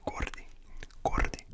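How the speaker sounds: usual speaking voice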